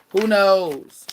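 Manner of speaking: high, loud, drawn-out question; high call-out. Real, not acted. Musing to viewers about popping out with someone new, loud high call-out.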